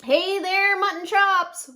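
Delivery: lightly voiced shifty stranger; sly wolf greeting. A narrator's reading. Wolf in disguise greeting the sheep, lightly sly. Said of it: "said the, uh, sheep".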